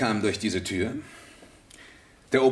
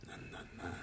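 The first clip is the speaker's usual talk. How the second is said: hushed protest; 19 dB quieter, much faster than usual. Acted. Redding hushed by the inspector.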